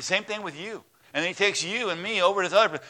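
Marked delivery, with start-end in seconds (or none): none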